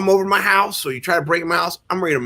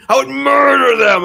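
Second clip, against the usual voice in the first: loud, slow, higher murderous yell; mock shout; 10 semitones higher, 7 dB louder, slower than usual. Father swearing to kill intruders, loud slow shout. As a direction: bellow the threat slowly.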